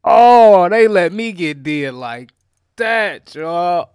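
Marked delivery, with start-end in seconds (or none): 0.0-0.9 loud high cry of dismay; loud stunned oh. Real, not acted. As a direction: let it burst out in shock. Realizing he got robbed.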